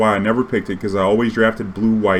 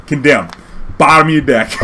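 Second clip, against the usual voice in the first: triumphant reveal; 4 semitones higher, 5 dB louder than usual. Retold or imitated. Springing the trap.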